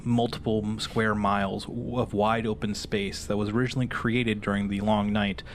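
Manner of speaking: ordinary delivery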